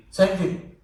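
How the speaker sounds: ordinary talk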